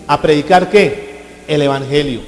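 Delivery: loud, slow preached verse; preached scripture. Acted. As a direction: declaim it slowly, loud.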